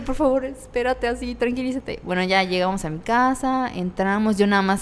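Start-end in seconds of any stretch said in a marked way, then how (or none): 0.0-2.0 pleading. Retold or imitated. Calming drunk friend in car.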